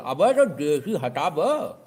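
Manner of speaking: know-it-all telling shopkeeper to remove desi, mock scold. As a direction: drag out the command with scorn. Customer ordering word removed.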